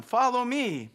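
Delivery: lightly called out, pitched high; gentle calling. A narrator's reading. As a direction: call them to come along. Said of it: "calling them".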